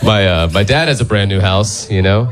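normal talking voice

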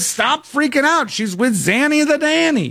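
high, breezy brush-off; dismissive Casey. Retold or imitated. Casey brushes off her mom's panic, high and airy. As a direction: wave away the worry in a lifted voice.

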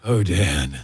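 soft, low, breathy parody sigh; mock the singer's swoon, hushed and low; hushed, breathy "Oh, Dad" lampooning a sultry singer. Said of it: "in that breathy voice".

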